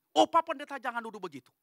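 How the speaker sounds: mock indignant objection to the pastor; protesting. Congregant denying the pastor's accusation, protesting.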